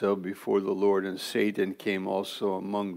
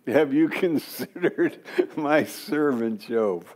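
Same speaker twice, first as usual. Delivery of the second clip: higher voice, laughing mid-line; amused mock quote; 6 semitones higher than usual. Pastor teasing the verse to the congregation, laughing.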